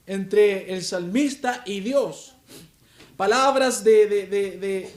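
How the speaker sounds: loud, slow, emphatic pulpit preaching; preaching at full force. Acted. Pastor opening his sermon to the congregation, full-force preaching. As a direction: preach it out to the whole church, slowly.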